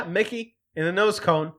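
ordinary talk, nothing marked

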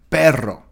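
PERRO with a clearly trilled double R; clear rolled R. Shown as a demonstration. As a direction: roll the "rr" in "perro" distinctly. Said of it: "La R, por ejemplo. La R". ("perro" con la erre bien vibrada)

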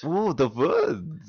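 slow, drawn-out mock German; German accent. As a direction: echo it in an exaggerated German accent. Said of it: "speak with your best German accent".

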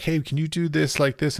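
hint of a client asking for a tweak; imagined client. Client asking the artist for changes, mock polite request.

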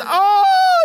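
high, loud startled cry; frightened shout. Retold or imitated. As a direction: yelp in terror at the monster. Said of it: "looks and says".

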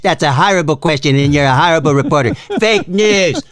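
mock Trump voice, brash and dismissive; Trump impression. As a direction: bark it like Trump mocking a reporter. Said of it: "Donald Trump never takes a seventh of a second".

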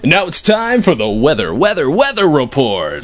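hammed-up TV anchor intro, stretching out weather; announcer voice. Host introducing the weather report, announcer voice. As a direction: play the news anchor tossing to weather.

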